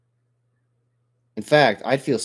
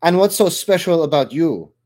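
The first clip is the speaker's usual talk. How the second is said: loud, higher sneer at the pale woman; 8 semitones higher, 10 dB louder than usual. A narrator's reading. Drop trooper taunts Lori across the compartment, loud sneering jeer. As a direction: jeer it out, looking down on her.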